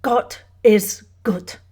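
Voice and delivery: German matriarch, guttural and deliberate; heavy German accent. A narrator's reading. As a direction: space each word carefully in a German accent. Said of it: "heavy German accent, the careful spacing of words".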